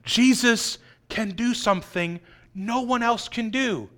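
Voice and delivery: ordinary talk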